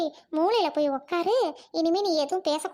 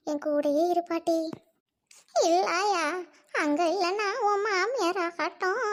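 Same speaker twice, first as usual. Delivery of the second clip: ordinary delivery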